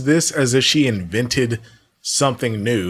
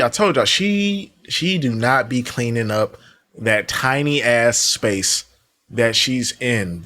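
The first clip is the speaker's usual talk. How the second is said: normal talking voice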